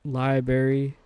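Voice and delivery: "Library" said as "lie-berry"; southern pronunciation. Shown as a demonstration. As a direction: drop the first r in "Library", southern style. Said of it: "that's a southern accent thing".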